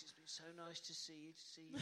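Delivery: put-on very soft, frail old entertainer; a dying showman's feeble catchphrase; Bruce Forsyth weak voice. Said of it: "in a very weak voice".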